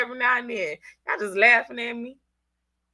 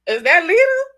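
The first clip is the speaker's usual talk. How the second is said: high, loud incredulous question; shocked listener; 8 semitones higher, 8 dB louder than usual. Retold or imitated. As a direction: ask it in mock disbelief. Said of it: "You be like".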